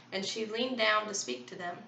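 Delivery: usual speaking voice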